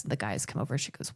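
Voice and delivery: ordinary delivery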